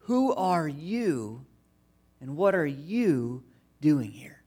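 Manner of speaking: slow, fading into a whisper; reflective whisper. Acted. Preacher turns the guard's question on the listeners, hushed and slow.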